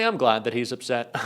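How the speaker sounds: usual speaking voice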